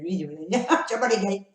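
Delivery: chewing while talking; chewing sticky toffee. Real, not acted. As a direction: mouth stuck with candy. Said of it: "Oh, que ça colle aux dents".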